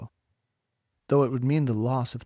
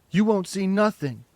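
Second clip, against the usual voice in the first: loud, high jeering; savage jeer; 6 semitones higher, 5 dB louder than usual. A narrator's reading. Bully jeering at Margot, loud and savage. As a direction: sneer it savagely. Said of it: "cried the boy savagely".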